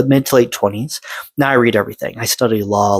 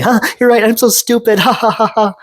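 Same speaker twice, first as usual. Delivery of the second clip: much higher, fake cheerful self-mocking laugh; forced laugh; 12 semitones higher than usual. Boy hiding hurt from his family, forced laughter. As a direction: play along with a forced, put-on laugh. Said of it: "I always had to laugh".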